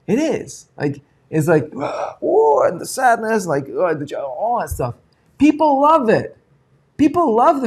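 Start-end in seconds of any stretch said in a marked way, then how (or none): 1.8-2.6 gasp of emotion. Retold or imitated.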